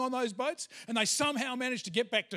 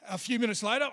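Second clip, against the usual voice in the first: ordinary delivery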